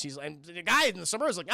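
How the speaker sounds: ordinary talk, nothing marked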